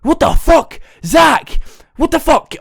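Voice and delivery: high, loud outburst of disbelief; acted shocked shouting. Friend sees Zach passed out, imitated shocked shouting. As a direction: re-enact a friend shouting in alarm.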